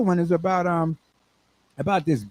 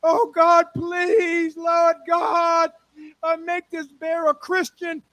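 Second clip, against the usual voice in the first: desperate prayer; 11 semitones higher, slower than usual. Acted. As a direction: beg God at the top of your voice. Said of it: "He started on his knees and said".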